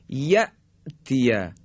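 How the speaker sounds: یأتیه with a sharp stop and brief hold after یأ; sharp still hamza. Shown as a demonstration. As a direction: cut یأتیه hard at the hamza, pause, go on. (یأتیه با همزهٔ تیز و مکث)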